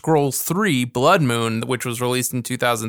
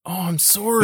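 mock Canadian; 5 semitones higher than usual. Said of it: "they always said sorry on it".

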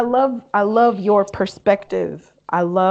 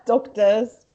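put-on London accent; 3 semitones higher than usual. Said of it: "Where did the accent come from?".

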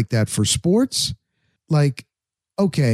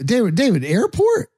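anxious mock question; 8 semitones higher than usual. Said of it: "I didn't wanna say his wrong".

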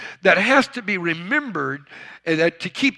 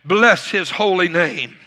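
louder, higher preaching voice, slow on key words; preaching scripture; 4 semitones higher, 4 dB louder, slower than usual. Acted. Pastor reading Psalm 103 to his church, full-force preaching. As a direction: proclaim the psalm to the congregation.

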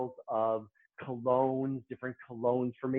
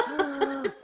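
gasp; 11 semitones higher than usual. Retold or imitated. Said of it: "And I went".

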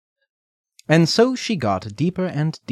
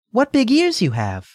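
put-on wondering little girl; 13 semitones higher, 4 dB louder than usual. Redcap questions grandmother.

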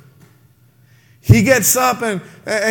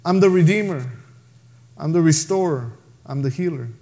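gentle Jesus; 5 semitones lower than usual. Retold or imitated. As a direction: offer help tenderly to a broken man. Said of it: "comes up to him and says".

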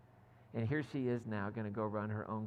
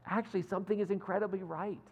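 higher, held-back thrill; mildly voiced excitement, kept in check; contained excitement; 6 semitones higher than usual. Keeley telling Higgins good news, holding back excitement. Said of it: "holding back her excitement".

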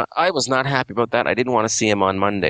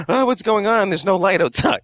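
mock panicked waking; 10 semitones higher than usual. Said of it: "freak out".

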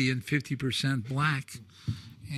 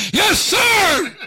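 sharp shouted reply, much higher and louder; Marine shout; 9 dB louder than usual. Retold or imitated. Marines shouting back to the storyteller, a loud high yell. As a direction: bark it back like a recruit.

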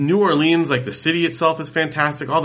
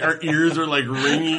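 normal talking voice

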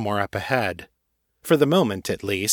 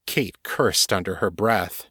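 plain reading voice

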